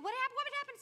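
high excited what happened; husband asking. Retold or imitated. Husband back from the bathroom, eager and high. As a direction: ask eagerly, pitch way up. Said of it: "And he would say".

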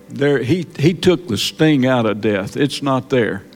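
usual speaking voice

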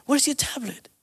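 distract a little one gently, pitch up; coaxing a toddler. Retold or imitated. Dad distracting upset toddler.

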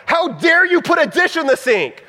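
mock blow-up at the roommate over a dish; exploding in anger. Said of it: "you just explode in anger".

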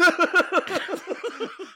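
high, fake blubbering; hammed-up stream of whimpering sobs; mock sobbing. Fish song begging for its life, mock sobbing. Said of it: "he's crying".

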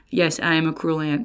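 normal talking voice

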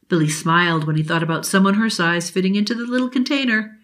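ordinary delivery

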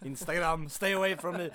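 loud, high mock warning; playful loud warning; mock plea. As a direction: shout it at the listeners as a joke. Mock plea to Instagram listeners.

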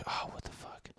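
breathy startled whisper under his breath; hushed what the fuck; whispered aside. Real, not acted. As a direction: drop to a whisper as if something caught him off guard. Sudden aside mid-story.

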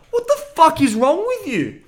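loud, high-pitched disbelief, re-enacted; exasperated outburst. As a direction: holler it at a friend, voice shooting up. Acts out exasperated reply to friend.

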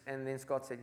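ordinary talk, nothing marked

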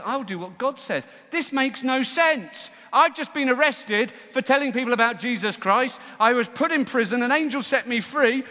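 loud, high-pitched disbelief; worked-up, incredulous complaint; exasperated protest. Acted. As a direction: protest it at full volume. Disciple protests being sent back.

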